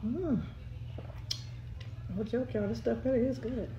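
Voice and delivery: lips pursed on straw; muffled between sips; speaking around a straw. Real, not acted. Seen 0: Glass straw held between lips throughout, sipping while speaking.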